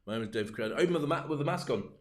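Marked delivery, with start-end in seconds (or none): 0.1-0.7 lower, softer flat news anchor voice; stiff newsreader. Shown as a demonstration. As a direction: read it like a dull newsreader. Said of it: "but I was to go".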